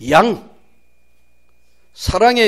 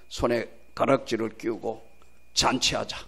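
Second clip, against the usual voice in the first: usual speaking voice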